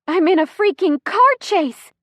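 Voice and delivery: high, loud, exasperated rant; pained outburst. A narrator's reading. Chrissy in labor rants during car chase, exasperated. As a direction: let it burst out high and loud.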